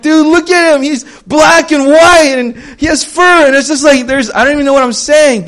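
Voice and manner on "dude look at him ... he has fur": excited. Retold or imitated. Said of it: "I'm like".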